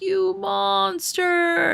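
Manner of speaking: put-on high, stretched wail of a crying kid; mock the kid's slow, high sobbing cry; imitating crying kid. The kid cries at her mother, imitated high wail. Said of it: "She was like".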